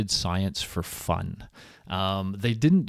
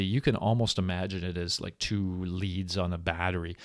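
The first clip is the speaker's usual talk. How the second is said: ordinary talk, nothing marked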